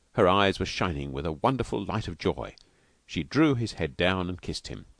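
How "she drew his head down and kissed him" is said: ordinary delivery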